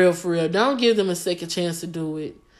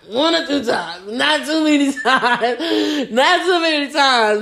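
giggling through the words, higher; laughing; 5 semitones higher, slower than usual. Real, not acted. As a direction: laugh it out, caught admitting it. Laughing at her own admission.

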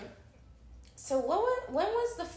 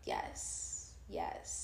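whisper; 14 dB quieter than usual. Real, not acted. Agreeing in a sultry whisper.

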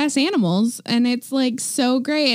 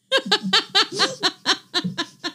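long breathy giggle; stifled laugh; 5 semitones higher than usual. Real, not acted. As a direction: laugh helplessly. Laughing at a spluttering co-host.

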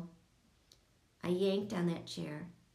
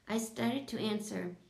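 ordinary delivery, nothing marked

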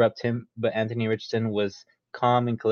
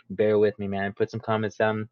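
ordinary delivery

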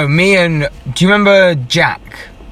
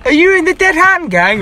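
high cartoon bully voice, pitched way up; mock cartoon kid's voice; Beefy Bert impression; 11 semitones higher than usual. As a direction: put on a silly cartoon character voice. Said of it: "that was a very good impression".

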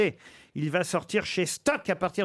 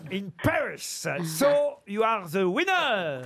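put-on English accent. Host declares the teacher the winner, playful English accent. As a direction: proclaim the winner in your best English accent. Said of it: "je vais utiliser mon meilleur accent anglais".

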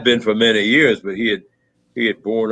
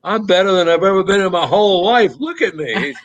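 higher and quick, a joyful imitated brother; brother overjoyed; 10 semitones higher, 3 dB louder than usual. Late brother tells Tom he's never felt better, imitated overjoyed and higher. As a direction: burst out with relief, freed at last. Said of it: "He said".

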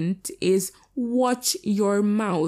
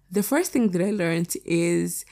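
usual speaking voice

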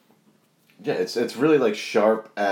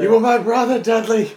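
a hammed-up wail, much higher and louder; melodramatic anguished yell; 7 dB louder than usual. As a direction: scream it like a betrayed hero. Parody of betrayed-brother scream.

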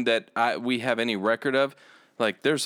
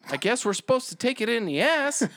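mock naive thought; 8 semitones higher than usual. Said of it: "I thought".

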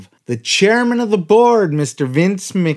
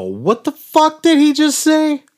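high, louder stunned outburst; shocked disbelief; 12 semitones higher, 3 dB louder than usual. Retold or imitated. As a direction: blurt it out in total disbelief. Retells his shocked reaction.

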